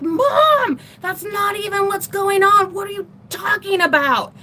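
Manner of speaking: yelling at mom. Retold or imitated. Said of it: "And I'm yelling".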